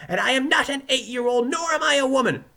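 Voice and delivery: high, loud indignant outburst; indignant protest. Acted. Weevil protesting to the judge, indignant. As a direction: protest loudly at a high pitch.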